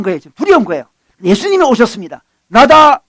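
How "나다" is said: loud, high proclamation; forceful announcement. Retold or imitated. Preacher as Jesus arriving at the boat, loud announcement.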